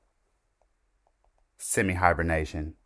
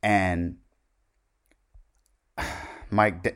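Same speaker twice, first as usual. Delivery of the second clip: usual speaking voice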